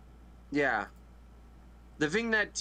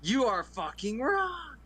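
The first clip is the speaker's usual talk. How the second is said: indignant; 6 semitones higher than usual. Real, not acted. Friends argue over a 4K release.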